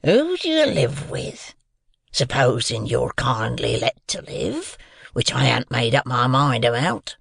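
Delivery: menacing convict. A narrator's reading. Convict decides whether the boy lives, menacing.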